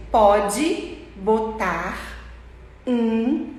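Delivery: slow, separated words. Shown as a demonstration. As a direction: pause between every word. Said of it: "Em inglês é a mesma coisa". (palavra por palavra, bem devagar)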